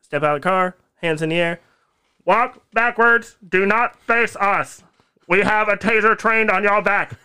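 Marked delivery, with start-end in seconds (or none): 2.2-7.1 higher, slow, loud police orders; slow cop command. Retold or imitated. Cop orders the driver to walk backwards, slow and loud. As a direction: spell each order out like a cop. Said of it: "cop orders in retold arrest".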